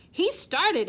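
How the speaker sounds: loud, high accusation; cried out. Acted. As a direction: point the blame, out loud. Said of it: "he cried".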